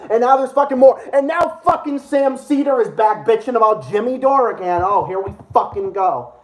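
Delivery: normal talking voice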